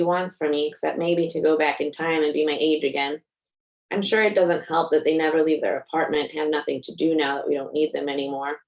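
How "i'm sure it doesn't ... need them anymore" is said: plain reading voice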